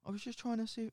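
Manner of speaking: put-on high, soft old lady voice; small and wounded, like a gentle old lady; hurt old lady. The old lady on the train, hurt, in a high soft voice.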